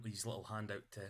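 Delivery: ordinary talk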